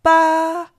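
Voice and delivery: Ba held high and level; first tone. Shown as a demonstration. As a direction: keep Ba flat and high. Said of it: "Here are the four tones".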